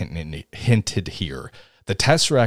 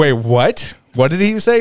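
high, loud baffled asking; bewildered, straining to understand; mock confusion at garbled speech; confused; 6 semitones higher, 4 dB louder than usual. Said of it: "and I'm like".

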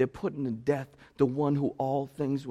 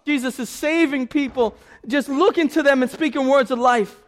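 louder, higher passionate sermon; fiery preaching; 10 semitones higher, 10 dB louder than usual. Acted. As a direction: preach the climax with full force. Preaching at full force.

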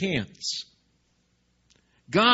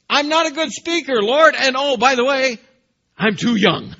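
very high, very loud protesting excuses; anxious excuses; 12 semitones higher, 13 dB louder than usual. Acted. Jeremiah protesting to the Lord, high and loud. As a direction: blurt out every excuse at once. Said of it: "Jeremiah's response in verse six says".

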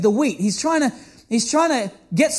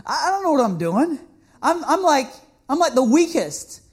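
Gideon's panic; 3 semitones higher than usual. Retold or imitated. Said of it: "Gideon's kinda thinking".

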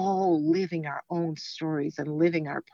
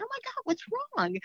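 high, startled oh my God; retelling her alarm at finding a worried stranger; concerned surprise; 5 dB quieter, faster than usual. Retold or imitated. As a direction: gasp it out with sudden worry. Asking a worried singer in bathroom.

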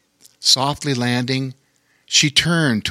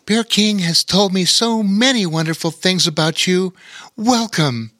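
higher, put-on storybook queen voice; welcoming queen; 6 semitones higher than usual. A narrator's reading. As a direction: welcome them as a gracious bear queen. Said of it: "Bear Queen said".

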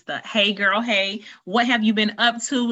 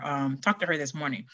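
ordinary talk

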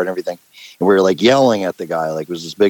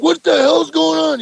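high, loud and slow, a furious cop; hammed-up cop yell, pitched high; angry cop yell; 5 dB louder than usual. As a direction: shout it down at the kids like a power-tripping cop. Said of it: "he's like".

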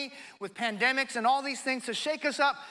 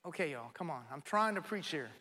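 much lower, softer aside to the crowd; joking aside; 7 semitones lower, 8 dB quieter than usual. Real, not acted. Preacher coaxing a quiet congregation for a response, joking aside. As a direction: drop out of preaching voice, low and offhand.

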